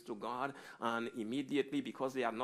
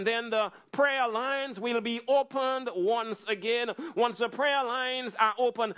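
higher, louder, rousing sermon climax; preaching at full force; 10 semitones higher, 8 dB louder than usual. Acted. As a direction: lift the congregation with rising fervor.

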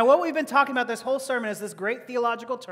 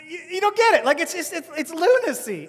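high, loud incredulous protest; mock exasperation; 7 semitones higher, 5 dB louder than usual. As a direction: protest as if they're hopelessly missing it. Acted exasperated reaction to guitarist's logic.

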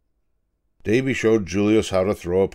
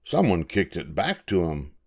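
ordinary delivery

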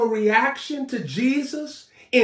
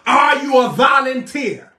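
ordinary talk, nothing marked

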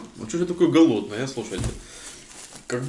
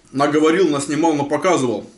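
chewing between words; talking while eating. Real, not acted. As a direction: mouth partly full. Seen 2: Forks food from plate of meat pieces while talking, likely chewing.

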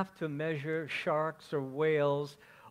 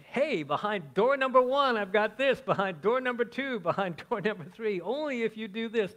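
loud, high hammed-up game show host; 8 semitones higher, 8 dB louder than usual. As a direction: sell the prizes like Monty Hall. Mocking God as game show host.

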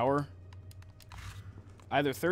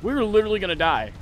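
panicked shouting; 6 semitones higher, 8 dB louder than usual. Real, not acted. Panicking, expecting to die.

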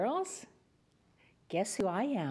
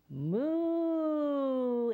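higher, drawn-out moo; cow moo; 5 semitones higher than usual. Shown as a demonstration. Storyteller coaxes the children to moo again, with a long higher moo. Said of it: "One more time".

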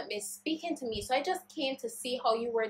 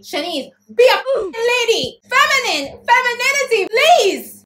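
high, loud shriek at herself; inner scream; 12 semitones higher, 12 dB louder than usual. Retold or imitated. Her own brain screaming at her to be ladylike, a loud high shriek. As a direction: scream at yourself to act like a lady. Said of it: "My brain now is just screaming at me".

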